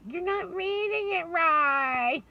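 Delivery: put-on high, loud, slow child's whine; whiny child complaint. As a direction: stretch it out like a spoiled little girl. Daughter complains about stepdad's reading.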